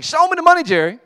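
very loud, high, hollered catchphrase; imitated demanding yell. Rod demands proof from Jerry on the phone, loud yell. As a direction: holler it like a fired-up athlete. Rod yells in his kitchen.

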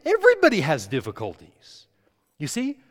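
loud, fervent preacher's roar; preaching. Acted. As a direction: preach it out to the back row. Preaching at full force.

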